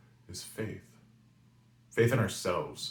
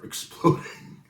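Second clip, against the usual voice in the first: word dissolving into laughter; laughing; 6 dB louder, slower than usual. Real, not acted. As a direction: laugh right through the word, drawn out. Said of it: "I'm laughing now".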